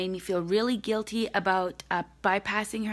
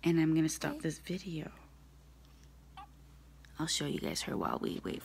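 whisper; 4 semitones lower, 8 dB quieter, faster than usual. Real, not acted. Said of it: "my baby might start crying".

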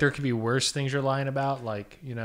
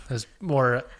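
ordinary delivery

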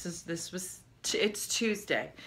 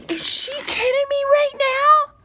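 high, loud disbelieving cry; exasperated outburst; 10 dB louder than usual. Real, not acted. Mom discovering yet another glass, loud exasperated cry. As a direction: let the outrage burst out high.

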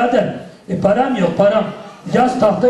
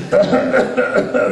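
laugh; 5 semitones higher than usual. Acted. Laughs at broken tooth.

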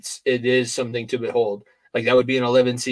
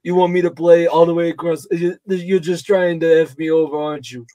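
put-on aggrieved New York–New Jersey accent, pitched up; Dan Hurley; 4 semitones higher than usual. Coach griping about travel arrangements, New York-Jersey accent. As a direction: put on a complaining Long Island coach's accent. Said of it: "that is literally a Dan Hurley accent".

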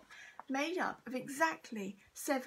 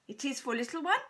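lightly voiced shopkeeper; 5 dB louder, faster than usual. A narrator's reading.

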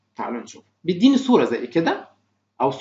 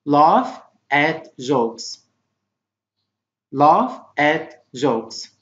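very slow, separated words; slow model reading; much slower than usual. Shown as a demonstration. Teacher reading vocabulary for pupils to repeat, slowly. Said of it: "Try to repeat after me".

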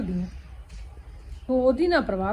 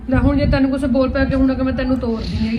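loud, insistent defiance; defiant shout; 8 dB louder than usual. Acted. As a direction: shout her down, she won't back off. Daughter defies angry mother.

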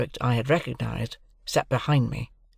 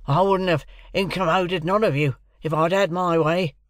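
gruff voice, pitched up high; higher-pitched, emphatic convict character; 6 semitones higher, 4 dB louder than usual. A narrator's reading. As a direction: complain gruffly as a second convict. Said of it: "said the other gruffly".

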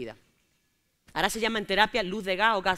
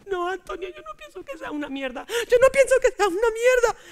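1 tearful shouting; 9 semitones higher, 6 dB louder than usual. Retold or imitated. Her crying argument reenacted.

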